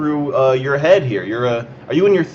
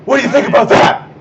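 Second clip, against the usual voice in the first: high, loud, fast taunt; agitated; 8 semitones higher, 5 dB louder, faster than usual. Acted. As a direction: fire the question at him. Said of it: "even though you sound kinda worked up".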